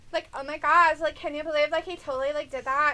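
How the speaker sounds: loud, exaggerated Valley girl voice; hammed-up Valley girl gossip. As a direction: gush like a mock Valley girl. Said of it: "talks like a Valley girl".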